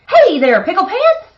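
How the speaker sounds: high, loud call across the yard; hollering mom. Acted. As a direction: holler for the kitty boy from the washing line. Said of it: "hollered Mama".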